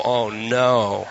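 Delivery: low, drawn-out mock dismay; dismayed groan. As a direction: sink the voice in comic dread. Listener groaning at the bragging.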